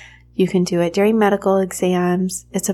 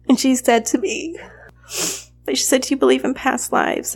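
higher, tearful voice; choked up; 6 semitones higher than usual. Real, not acted. Mother retelling her massage therapist's comforting question, choked up. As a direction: keep telling it through real welling tears. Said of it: "I'm sorry, getting choked up here".

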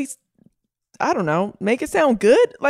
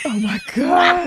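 shocked exclamation; 8 semitones higher, slower than usual. Real, not acted. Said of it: "reacting to lie".

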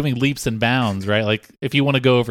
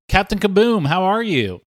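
talk-show host intro; 8 semitones higher, 3 dB louder than usual. Acted.

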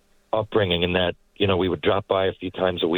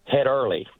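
Newfoundland accent; 4 semitones higher than usual. Shown as a demonstration. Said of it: "or drop one".

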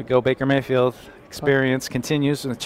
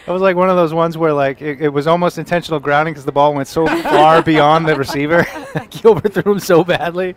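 laughing; 5 semitones higher, 6 dB louder than usual. Real, not acted. Laughing at a terrible throw.

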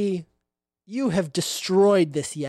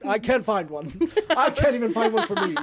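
Santa voice; 4 semitones higher, faster than usual. Acted. Voicing Santa to player.

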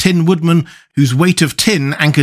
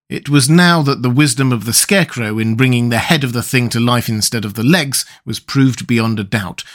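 plain reading voice

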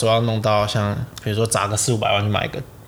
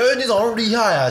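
surprised; 11 semitones higher, faster than usual. Retold or imitated. Friends fooled, acting their amazement.

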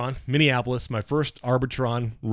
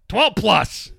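loud, high-pitched reveal; triumphant announcement; 11 semitones higher, 7 dB louder than usual. Retold or imitated. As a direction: imitate a boss proclaiming big news. Boss calls out next category.

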